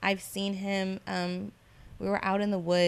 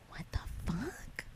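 breathless whisper, slow and stunned; shocked whisper; 2 semitones lower, 13 dB quieter, slower than usual. Retold or imitated. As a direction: whisper it as if someone might hear. Reacting in secret inside the dream.

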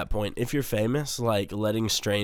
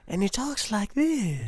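very high, breathy-light mock voice; 13 semitones higher, slower than usual. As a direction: squeak it out like a pop star. Imitating [name] voice.

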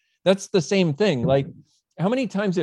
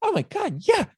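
mock amazed client. Client answering, retold.

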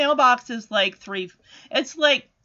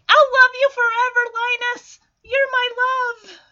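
lovestruck calling out; 9 semitones higher, 4 dB louder than usual. Acted. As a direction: call it out high as if being dragged away. Sally calls while being dragged away.

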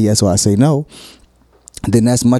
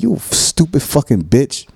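mock harsh insult; 6 semitones higher than usual. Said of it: "That sounds very harsh".

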